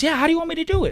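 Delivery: high-pitched retold woman's reply; imitating her. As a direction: put her answer up high, lightly mimicked.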